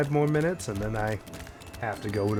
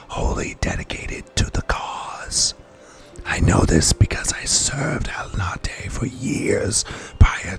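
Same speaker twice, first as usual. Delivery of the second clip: forceful, loud stage whisper; aggressive whispering; 4 semitones lower, 5 dB louder than usual. Acted. Streamer reading a secretive NPC, whispering hard. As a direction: hiss it urgently to a conspirator. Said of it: "Aggressive whispering".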